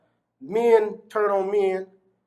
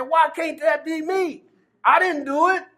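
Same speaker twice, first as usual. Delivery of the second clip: pleading; 8 semitones higher than usual. Retold or imitated. Inner plea, watching freed men on TV.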